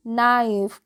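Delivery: "नाइफ" beginning straight on the n; silent K. Shown as a demonstration. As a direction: repeat "नाइफ" firmly without any k. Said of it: "के जो होती है वो silent होती है".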